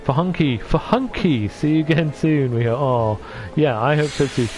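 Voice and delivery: normal talking voice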